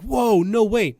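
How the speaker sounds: hammed-up astonished gasp of a line; mock amazement. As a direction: exclaim it as a sarcastically wowed audience. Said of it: "you're supposed to be like".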